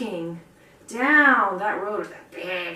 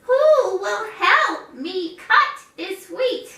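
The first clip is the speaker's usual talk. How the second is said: much higher, slow whiny hen voice; pleading hen; 10 semitones higher, 5 dB louder, much slower than usual. Acted. Hen asks the neighbors, high slow plea. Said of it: "and she said".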